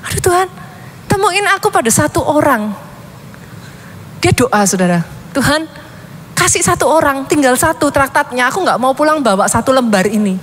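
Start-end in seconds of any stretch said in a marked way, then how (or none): 0.1-2.7 put-on higher, pleading little boy calling on God; a preacher voicing a boy's anxious plea, higher; desperate child's prayer. Child praying in the rain. 5.3-10.4 put-on higher, pleading little boy calling on God; a preacher voicing a boy's anxious plea, higher; desperate child's prayer. Child keeps praying for someone.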